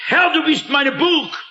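a preacher voicing the psalmist's proud confidence. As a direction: announce it like a man sure of his fortress. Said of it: "ganz stolz".

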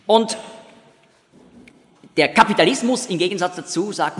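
ordinary talk

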